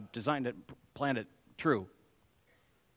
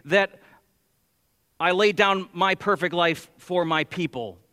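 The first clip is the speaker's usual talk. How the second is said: raised, louder pulpit voice; forceful preaching; 5 semitones higher, 7 dB louder than usual. Acted. Preacher to congregation on why blood must be shed, forceful preaching.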